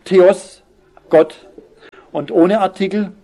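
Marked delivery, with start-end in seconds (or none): 0.1-1.5 Theos and Gott each set apart and said louder, with a pause between; emphatic, louder. Shown as a demonstration. Preacher stressing the Greek word to his congregation, louder and set apart. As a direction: land hard on Theos, then hard on Gott. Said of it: "betont vorangestellt".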